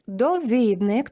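drawn-out довіднИк; stress on ник. Shown as a demonstration. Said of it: "часто помиляються". (повільно, наголос довіднИк)

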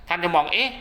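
ordinary talk, nothing marked

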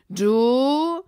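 loud and high, barked; single him out sharply; sudden loud challenge. A narrator's reading. Bill turning on the young cowboy, loud bark.

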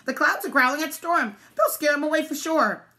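high, loud, excited duck; elated high-pitched duck; quacking duck. A narrator's reading. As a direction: cheer on the clouds. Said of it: "Duck quacked".

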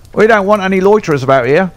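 a stable hand barking at intruders; gruff groom. Acted. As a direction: chase the loiterers away quickly. Groom warns off strangers.

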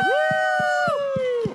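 high excited whoop; cheering woo. Real, not acted. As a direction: whoop for the crowd. Cheering guest arrival.

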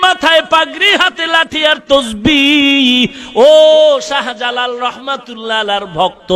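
usual speaking voice

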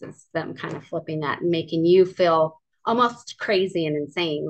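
usual speaking voice

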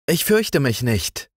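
put-on high, clear little girl's voice; girl in a dark cave, bright and unafraid; bright child voice. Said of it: "sagte sie mit heller Stimme".

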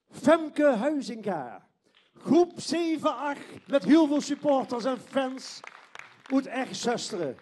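slow, loud stage announcement; announcer voice. Real, not acted. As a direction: stretch the name out for the crowd.

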